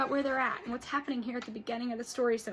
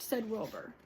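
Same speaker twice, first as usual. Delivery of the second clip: lively read-aloud with character voices; storybook read-aloud for kids; reading to children; 5 semitones higher, 8 dB louder than usual. Acted. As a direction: read it to the class with expression.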